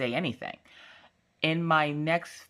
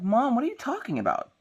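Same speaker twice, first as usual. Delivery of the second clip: put-on high, baffled kid voice; exasperated child; 11 semitones higher than usual. Daughter questioning mom's nugget story, exasperated high voice. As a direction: imitate a child protesting in disbelief.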